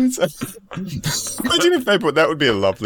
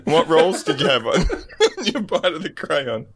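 laughing; 7 semitones higher than usual. Real, not acted. Still laughing.

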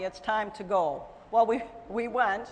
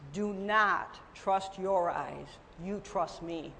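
slow, soft and solemn; God speaking gently; 5 semitones lower, 6 dB quieter, slower than usual. Retold or imitated. God speaking tenderly to the believer, slow and soft. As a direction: deliver it slowly as a gentle divine word. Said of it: "the Lord is telling".

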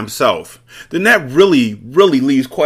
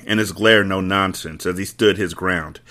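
plain reading voice

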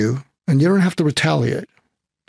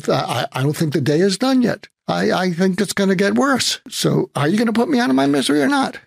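climbing much higher and faster; escalating plea; 8 semitones higher than usual. Retold or imitated. Desperate driver pressing the trucker for an answer, rising higher. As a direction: build to a desperate ask.

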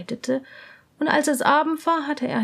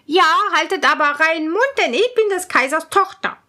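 higher, fast, loud warning princess; 5 semitones higher, 5 dB louder, faster than usual. Acted. Princess warns her ladies, high and quick. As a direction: hush them quickly and sternly. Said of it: "sagten sie alle".

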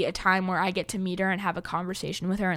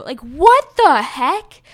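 loud, slow, higher disbelief; amazed exclamation; 5 semitones higher, 10 dB louder, much slower than usual. Real, not acted. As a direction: stretch it out in awe. Amazed at [name] naming fans.

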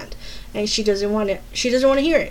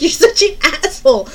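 loud, higher mock insult; amused outburst; 6 semitones higher, 9 dB louder than usual. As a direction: throw the insult out loudly. Retold banter with laughing brother.

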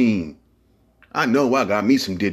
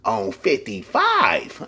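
ordinary talk, nothing marked

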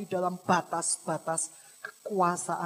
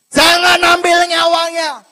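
thundering, high-pitched divine order; full-force preacher's shout; shouted command; 7 semitones higher, 18 dB louder than usual. Acted. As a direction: roar the order at the devil. God's command to devil, repeated shouting.

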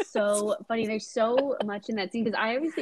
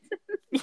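breathy high laugh; giggling at the joke; high giggle; 12 semitones higher than usual. Real, not acted. Laughing after quote.

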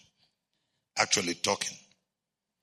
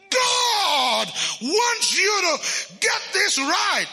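much higher, slow and loud, like fire; loud preaching shout; 4 dB louder, much slower than usual. Retold or imitated. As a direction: belt it out like a fired-up preacher. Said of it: "she became loud".

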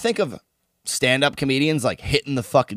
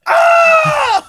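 very high, loud scream; hammed-up labour scream; mock birth scream; 10 dB louder than usual. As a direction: shriek as if giving birth. Mimes screaming in childbirth.

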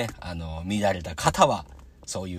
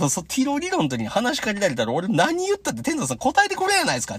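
high-pitched comic complaint; exasperated protest; 4 dB louder than usual. Retold or imitated. Podcaster complaining he never gets answered, exasperated. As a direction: plead the case in a raised, mock-aggrieved voice. Acted protest, retold to the wrestler.

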